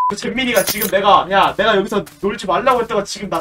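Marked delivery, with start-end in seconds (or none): none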